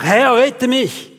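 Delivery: slow read-out shout of "Herr, rette mich"; cry for help. Acted. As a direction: cry out to the Lord, drawn out. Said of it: "schrie er und sprach".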